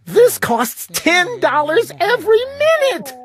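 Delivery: high, urgent voice; frantic little sister; alarmed sister. A narrator's reading. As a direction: plead high and fast-worried. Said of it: "my sister yelled".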